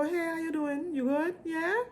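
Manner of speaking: a hint of a friendly Caribbean lilt; Caribbean accent. Greeting cruise ship visitors in a light Caribbean accent. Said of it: "I switch to, like, a little Caribbean non-specific accent".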